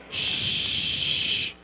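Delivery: unvoiced shush; hushing. Real, not acted. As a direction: hush the crowd. Hushing the room.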